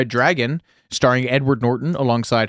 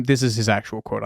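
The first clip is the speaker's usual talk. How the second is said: normal talking voice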